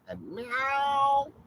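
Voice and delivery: high, loud cat meow; imitated meow. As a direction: squeal out a cat's meow. Imitating cats at night.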